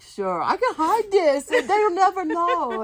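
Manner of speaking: high and loud, slow, giggling through it; laughing mock confidence. Recalling thinking she could hide the fall, laughing. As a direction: play up the naive plan with a laugh.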